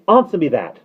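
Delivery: high, loud and cross; sharp nanny's order; angry demand. A narrator's reading. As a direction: insist on an answer angrily. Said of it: "she inquired angrily".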